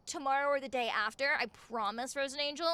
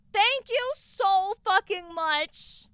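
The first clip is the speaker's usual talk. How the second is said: gushing thanks; 5 semitones higher, 5 dB louder, slower than usual. Real, not acted. Thanking donors.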